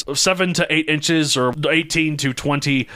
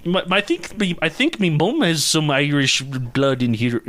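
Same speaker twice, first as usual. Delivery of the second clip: mock Irish accent; 4 semitones higher than usual. Host claiming Irish roots, mock Irish accent. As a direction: stumble into a jokey Irish lilt. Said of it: "American Irish or Irish Irish".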